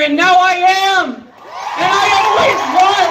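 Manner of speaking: rising, triumphant cry. Real, not acted. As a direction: proclaim it with joy. Triumphant declaration at rally.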